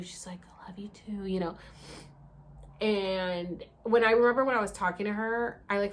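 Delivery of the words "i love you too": unvoiced whispered reply; just breath, no voice; soft hushed answer. Guide whispers back.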